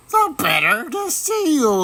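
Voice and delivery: a sly wolf hiding behind a granny voice; wolf posing as granny. A narrator's reading. Wolf in grandmother's bed answers the girl, high and sly.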